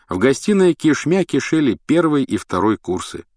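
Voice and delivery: ordinary delivery, nothing marked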